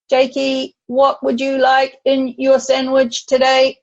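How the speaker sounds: granny talking robot at lunch. Retold or imitated. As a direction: playfully put on a robot voice for the grandson. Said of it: "we're gonna talk like a robot".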